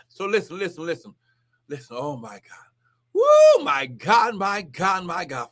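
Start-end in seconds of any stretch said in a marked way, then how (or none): 0.1-2.7 softer, breathy near-whisper; hushed whisper. Real, not acted. Preacher hushing his viewers before the prayer, whispered. 3.1-3.6 piercing high whoop, much louder; ecstatic woo. Real, not acted. Preacher whooping in praise before praying, piercing.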